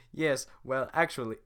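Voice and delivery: loud high botched Shrek impression; a rough first try at Shrek; failed Shrek voice. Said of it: "hard for me to nail the Shrek voice".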